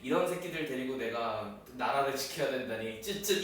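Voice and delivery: sigh, then soft scornful muttering; weary contempt. Retold or imitated. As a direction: quietly despair at these idiots. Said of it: "이러셨어".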